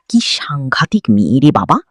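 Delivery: ordinary delivery, nothing marked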